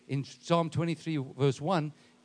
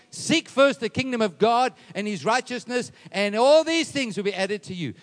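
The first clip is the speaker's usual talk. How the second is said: raised, driving scripture reading; impassioned preaching; 6 semitones higher, 7 dB louder than usual. Acted. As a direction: push the verse out with mounting force. Preaches scripture at full force.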